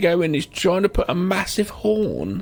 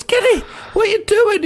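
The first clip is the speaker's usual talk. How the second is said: much higher, louder startled crook; high mock shout; 5 dB louder than usual. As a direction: shriek the name at Kenny. Quoting the permed crook.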